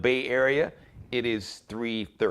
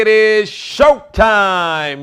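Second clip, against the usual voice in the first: loud, high hype host opening; show host intro; 9 semitones higher, 12 dB louder than usual. Acted. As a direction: open the show big, pumping up the crowd.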